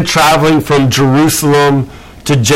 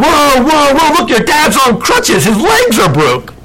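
high, alarmed whoa whoa; mock alarm at the crutches; alarmed interviewer; 9 semitones higher than usual. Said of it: "And the interviewer's like".

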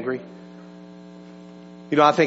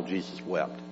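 hushed reverence; 11 semitones lower, 14 dB quieter than usual. Acted. Quoting the shortest Bible verse.

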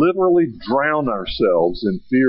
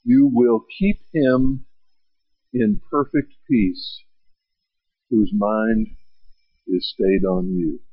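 slow gentle reading; 6 semitones lower, slower than usual. Shown as a demonstration. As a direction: let each phrase settle calmly. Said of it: "Listen to it".

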